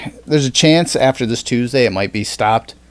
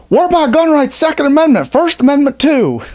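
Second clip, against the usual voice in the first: put-on high, loud hillbilly MAGA caricature in a Southern accent; 4 dB louder than usual. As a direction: ham up a Southern-accented MAGA guy, pitched way up. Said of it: "Why do they always gotta have a Southern accent?".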